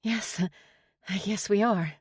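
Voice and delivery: a touch of breathiness in a hesitant reply; breathy. A narrator's reading. Teller answering the man she fancies, lightly breathy. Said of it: "My voice was breathy as I replied".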